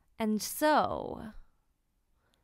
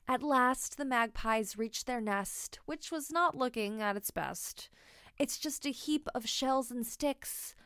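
ordinary delivery

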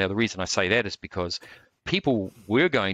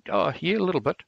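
modest little higher voice, quoting the martial artist; humbly; 10 semitones higher than usual. Retold or imitated. Martial artist modestly admits his skill, humbly. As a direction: play it down, shy and humble. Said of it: "very humbly".

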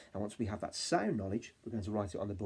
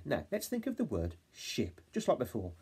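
normal talking voice

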